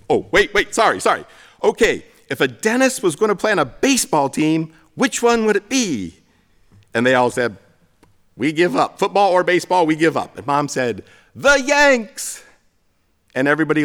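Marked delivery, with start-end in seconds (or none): 0.1-6.2 imitated mom restarting in a higher voice; flustered joke-teller. Mom restarts her botched joke for the family, mock flustered. 8.3-10.4 higher-pitched imitation of a baffled family; mild mock exasperation. Family answers mom's muddled joke, mildly mock exasperated. 11.3-12.4 very high, loud imitation of mom's punchline; mock triumphant punchline. Mom reveals the answer to the family, mock triumphant.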